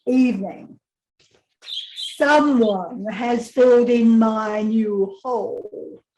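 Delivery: deadpan diary read with long pauses; reading to children. A narrator's reading. Author reading her wombat book aloud to a class, dry and deliberate.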